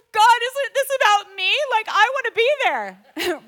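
high, loud plea to God; mock complaint. Mother complains to God about missing the baptism, in mock protest. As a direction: protest like someone left out.

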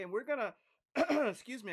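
throaty, clearing the throat mid-sentence; frog in throat. Real, not acted. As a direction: catch in the throat, then clear it. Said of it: "I got a little frog in my throat today".

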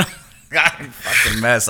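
normal talking voice

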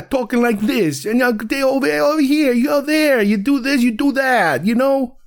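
high-pitched, exaggerated New York accent. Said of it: "stereotypical New York accents".

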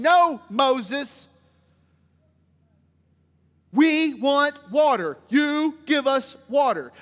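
slow, loud, emphatic demand; demanding crowd. Acted. The people confronting Moses for water, demanding. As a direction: lean hard on every word, insisting.

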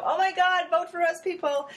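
high-pitched mock campaign appeal; excited plea. Acts out rallying people to vote.

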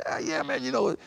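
very high, soft, sheepish admission; sheepish pitcher. Retold or imitated. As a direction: squeak out a guilty yes.

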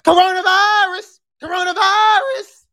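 squealed, stretched-out show shout; playful scream. Pretend. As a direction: scream it out like a catchphrase. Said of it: "I'ma scream one more time".

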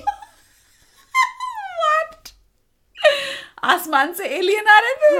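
high, loud laughing disbelief; astonished. Real, not acted. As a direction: shriek out the surprise, laughing. Reacting to sudden alien rain.

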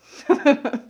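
a sudden loud embarrassed laugh; loud burst of laughter. Real, not acted. Laughing loud at her own gross hospital story.

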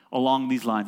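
ordinary talk

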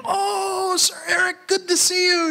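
put-on high, bright called-out hello to an old friend; delighted guard calling out; cheery greeting. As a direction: shout the welcome from across the gate. Guard greets him at gate.

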